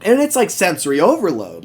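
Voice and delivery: ordinary talk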